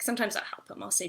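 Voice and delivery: ordinary talk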